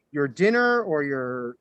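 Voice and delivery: ordinary talk, nothing marked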